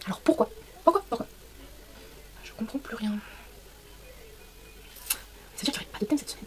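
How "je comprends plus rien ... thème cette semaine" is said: whispered to herself, confused; whispering. Real, not acted. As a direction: breathe it out under your breath.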